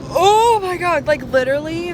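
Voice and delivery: loud and high with delight; thrilled exclaim. Real, not acted. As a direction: burst out in excitement. Thrilled inside the car wash.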